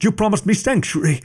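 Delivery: high-pitched protesting shout; frantic scholar crying out, pitched well up; betrayed scholar protesting to the captain, high shout. Acted. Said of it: "the scholar shouted".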